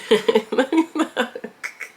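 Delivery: light, high-pitched laughter; laughing. Real, not acted. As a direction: crack up at your own joke. Cracks up at own joke.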